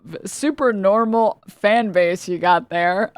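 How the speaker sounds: normal talking voice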